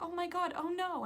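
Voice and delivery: mock alarm. Star reacting to friend beating friend, mock alarm.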